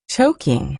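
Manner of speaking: plain reading voice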